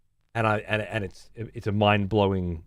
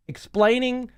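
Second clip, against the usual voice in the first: mock; 12 semitones higher than usual. Mocking villain's sales pitch.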